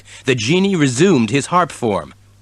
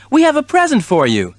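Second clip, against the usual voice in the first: kitten voice; 13 semitones higher, 3 dB louder, faster than usual. A narrator's reading. Kitten voice in story.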